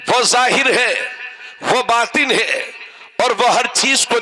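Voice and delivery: declaimed sermon in short phrases with long pauses; ringing, measured pulpit delivery; preaching. Acted. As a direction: preach it out line by line, holding the pitch.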